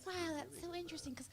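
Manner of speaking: high, put-on lady voice, marvelling; amazed dentist lady. Dentist lady wondering about Scottish people, high amazed voice. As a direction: reason it out brightly in a high voice. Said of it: "She said".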